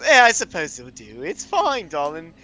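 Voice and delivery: put-on high, swooping posh accent; elegant posh accent. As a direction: grudgingly concede in an affected posh accent. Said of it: "is more elegant and such".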